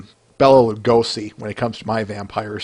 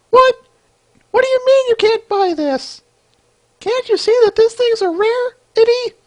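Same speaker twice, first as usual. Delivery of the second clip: much higher, outraged little voice; squeaky indignant seller. Acted. Comic seller insisting her item is a rarity, high and indignant. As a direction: squeal in protest at the refusal. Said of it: "voice acting".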